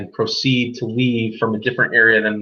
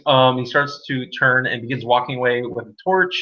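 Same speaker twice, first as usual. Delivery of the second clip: ordinary talk, nothing marked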